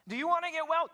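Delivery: high, loud, emphatic asking; urgent question. Acted. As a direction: throw out the question at full voice. Preacher acts the obvious question.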